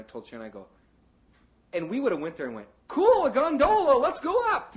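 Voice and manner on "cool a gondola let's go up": high, loud, gleeful cry; excited naive tourist. Retold or imitated. Preacher imitating himself as a tourist, gleeful and high. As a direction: cheer like a tourist eager to ride.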